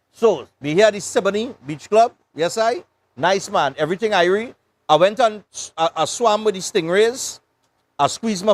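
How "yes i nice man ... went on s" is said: ordinary talk, nothing marked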